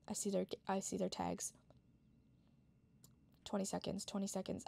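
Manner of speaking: soft tense whisper; hush, they're close; whispering while hiding. Real, not acted. Hiding, counting down quietly.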